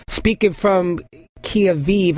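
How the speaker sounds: slow, careful Kyiv, sounded out as kee-ev-iv; struggling with Kyiv. Real, not acted. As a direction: feels his way through Kyiv. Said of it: "I can't say that word".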